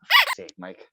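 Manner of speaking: loud, high mock exasperated boss. Boss cursing Mike over spilled water, mock exasperated. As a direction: lightly fake the boss's exasperation. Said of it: "he was like".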